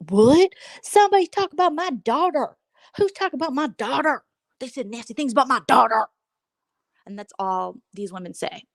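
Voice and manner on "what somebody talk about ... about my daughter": outraged mother. Retold or imitated. Momo voiced as outraged mother.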